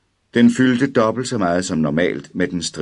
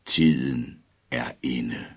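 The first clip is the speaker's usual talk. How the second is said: slow ominous pronouncement; 5 semitones lower, 4 dB quieter, slower than usual. A narrator's reading. Sinister figure speaks.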